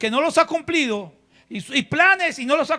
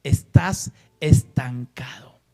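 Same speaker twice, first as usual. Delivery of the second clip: slow, low, deliberate preaching; preacher pressing the word on the congregation; 6 semitones lower, slower than usual. Acted. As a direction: let each line fall heavy. Preaching conviction.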